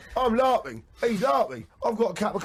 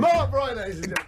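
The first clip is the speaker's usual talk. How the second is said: compere announcement; 6 semitones higher, faster than usual. Acted. Host presenting Mark Wright to the audience, showman announce. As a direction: announce him like a boxing MC. Announcing guest, ladies and gentlemen.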